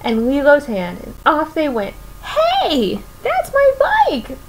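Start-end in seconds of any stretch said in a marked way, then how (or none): 2.2-4.4 yelled. A narrator's reading. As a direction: shout after the thieves in a high voice. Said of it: "Myrtle yelled after them".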